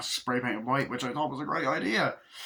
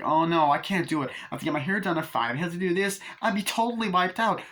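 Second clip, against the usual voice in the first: high, quick mocking whine; lightly voiced whiny girl complaining; 6 semitones higher than usual.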